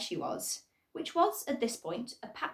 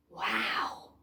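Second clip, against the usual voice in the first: awed. Acted. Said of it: "cried the voices".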